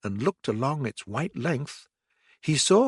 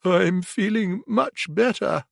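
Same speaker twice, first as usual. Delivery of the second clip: weak voice; 8 semitones higher than usual. A narrator's reading. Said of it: "said Toad in a weak voice".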